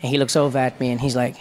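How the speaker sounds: ordinary talk, nothing marked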